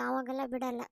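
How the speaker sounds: ordinary talk, nothing marked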